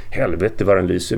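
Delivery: usual speaking voice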